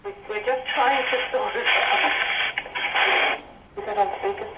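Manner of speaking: muffled speakerphone, hollow and far. Real, not acted. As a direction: speak away from the phone on speaker. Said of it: "it sounds like you're on a speakerphone".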